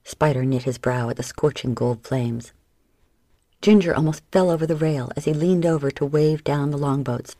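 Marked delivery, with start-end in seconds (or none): none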